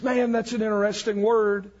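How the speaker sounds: normal talking voice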